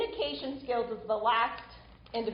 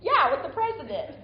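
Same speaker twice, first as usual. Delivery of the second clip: retold matter-of-course reply, higher and louder; nonchalant answer; 4 semitones higher, 5 dB louder than usual. Retelling the answer to a puzzled teenager, mock nonchalant. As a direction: confirm it as if it were obvious. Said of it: "he said this so nonchalantly".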